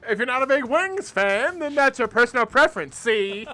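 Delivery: loud high 1950s announcer; brash newsreel sponsor read; old-timey voice. Pretend. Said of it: "The old-timey voice".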